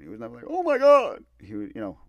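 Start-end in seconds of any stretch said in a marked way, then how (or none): 0.5-1.2 high, loud mock shriek of shock; exaggerated shock. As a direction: overdo the alarm, pitched way up. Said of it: "My dad never reached that level".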